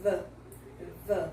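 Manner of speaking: 'V' as buzzing voiced th into schwa; 'V' as a short isolated 'thuh'; closed 'the'. Shown as a demonstration. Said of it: "vai trazer o som do chihuahua".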